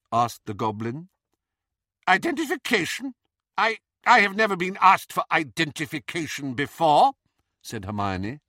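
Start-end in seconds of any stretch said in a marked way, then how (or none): 2.1-7.1 high, loud, fast outraged disbelief; indignant Bellatrix. A narrator's reading. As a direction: sputter with aristocratic outrage.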